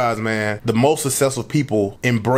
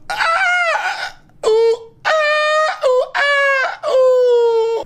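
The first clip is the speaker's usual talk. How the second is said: mock high strained yoga moans; much slower than usual.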